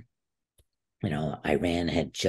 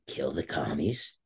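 low, slow, softer echo; retold awed reaction; 4 semitones lower, 5 dB quieter, slower than usual. Wrestler echoing his coach's rousing line, quietly struck. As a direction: repeat it slowly under your voice, taking it in.

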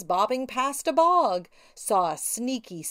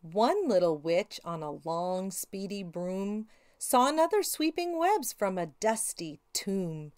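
plain reading voice

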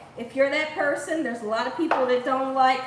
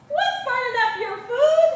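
laughing question; 9 semitones higher, 3 dB louder than usual. Real, not acted. Asks viewer, laughing.

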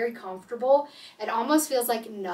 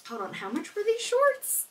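sudden doubt; 10 semitones higher than usual. Real, not acted.

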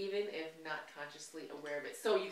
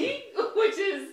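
laughing; 13 semitones higher, 11 dB louder, slower than usual. Real, not acted. Laughing at shared thought.

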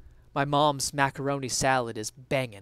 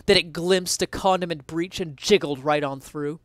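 ordinary talk, nothing marked

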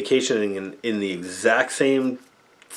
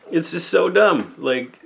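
exasperated; 8 semitones higher than usual. Real, not acted. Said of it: "exasperated rant".

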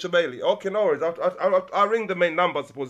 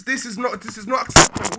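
getting worked up; 5 dB louder than usual. Real, not acted. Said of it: "I'm getting excited".

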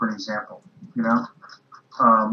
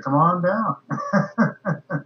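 higher, drawn-out taunt breaking into a laugh; quoted challenge; 7 semitones higher, much slower than usual. Retold or imitated. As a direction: call it out playfully, laughing after. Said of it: "Harry Reid said".

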